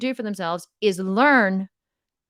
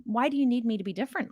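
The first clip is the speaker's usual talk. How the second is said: ordinary delivery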